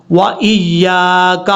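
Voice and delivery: slow 'وَإِيَّاكَ', held yaa; long alif. Shown as a demonstration. Teacher shows the lengthening, slow correct recitation. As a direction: stretch the middle of 'وَإِيَّاكَ'. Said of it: "لمبا کر کے پڑھنا ہے".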